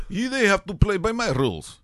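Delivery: put-on Zlatan's foreign accent. Soccer tough guy laying down his rules, mock foreign accent. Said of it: "I don't know why I did that accent".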